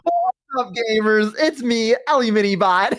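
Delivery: high, playful box-robot streamer. Pretend. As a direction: greet the chat as a cardboard robot. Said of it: "do, like, a box robot".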